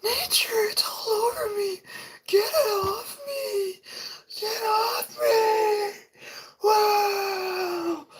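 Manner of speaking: very high whispered falsetto, frantic and crying; hysterical lady. Retold or imitated. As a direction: squeal like a panicking madwoman. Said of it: "I'm doing a type of voice like her".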